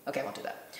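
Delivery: usual speaking voice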